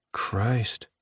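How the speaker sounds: soft awed whisper. Acted. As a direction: breathe it out softly in awe. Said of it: "Sam said in a whisper".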